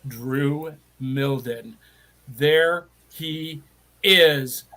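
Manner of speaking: slow, low announcer drawl. Acted. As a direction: announce the guest grandly. Announcer-style guest introduction.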